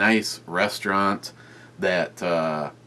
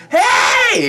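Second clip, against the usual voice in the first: high, loud excited shriek of a hey; screamed greeting; 11 dB louder than usual. Retold or imitated. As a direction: imitate her shrieking hello from close up. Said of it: "practically screams it".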